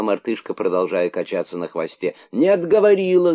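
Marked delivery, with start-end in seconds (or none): 2.3-3.3 sudden high indignant shout; high furious cry of protest. A narrator's reading. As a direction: yell it at the monkey, outraged. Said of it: "закричал находчивый".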